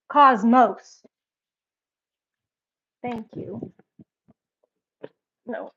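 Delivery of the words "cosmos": loud call. Real, not acted. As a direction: call the dog's name out loud. Calling dog's name loudly.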